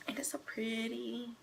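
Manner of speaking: breathy hushed admiration; soft whisper. Real, not acted. Vlogger whispering about her painted wall. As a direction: whisper it like a secret delight.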